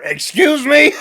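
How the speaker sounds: high, loud mock screech; imitated catchphrase. Co-host imitating the sudden backstage interruption, high loud screech. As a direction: shriek it as a mock-outraged interruption. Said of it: "All of a sudden you hear".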